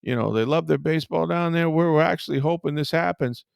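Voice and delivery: light imitation of an eager manager; Ozzie Guillen. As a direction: sell the town happily, mildly hammed. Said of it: "he was just like".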